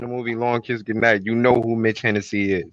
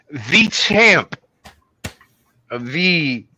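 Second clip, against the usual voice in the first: loud hyped-up guest introduction; ring announcer; 5 dB louder, slower than usual. Pretend. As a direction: bellow the name like a ring announcer. Hyping the guest's entrance.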